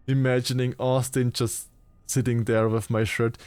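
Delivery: usual speaking voice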